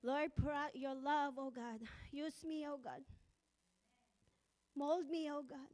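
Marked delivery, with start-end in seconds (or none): none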